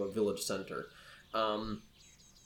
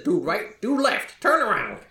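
put-on rising, louder old-timer commands; crotchety old man; 9 semitones higher, 5 dB louder than usual. Old man sending the adventurers off, crotchety escalating shout. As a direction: climb higher with each order to leave. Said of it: "this crotchety old man".

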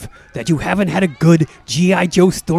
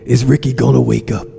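brooding coma patient; 5 semitones lower than usual. Acted. Mister Roma drifting in his coma, brooding low. Character inner monologue in coma.

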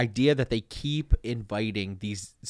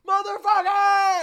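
much higher, slow drawn-out shouted cuss; rowdy party animal; 5 dB louder, slower than usual. Retold or imitated. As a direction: scream it like a hyped festival DJ. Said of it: "is so much different than, like".

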